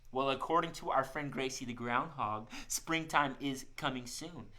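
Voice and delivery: normal talking voice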